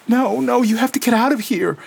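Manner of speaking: put-on high, small, urgent little-girl voice; childlike pleading. A narrator's reading. Lena pulling Gabriel away, childlike and frightened. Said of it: "the voice of a small child".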